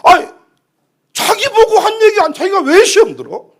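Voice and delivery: loud, high, irritated protest; exasperated complaint. Acted. Pastor irked that a member took offense, exasperated. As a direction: grumble loudly in disbelief.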